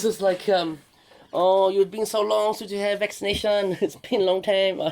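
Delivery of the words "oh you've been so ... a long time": high, loud mock Chinese accent; hammy prank-call Chinese accent. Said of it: "the Chinese accent".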